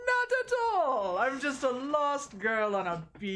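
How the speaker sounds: bad Russian accent, opening high; hammed-up innocent protest in Russian accent; Huntress. Huntress insisting she is harmless, in a high bad Russian accent. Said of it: "I didn't say I could do a good Russian accent".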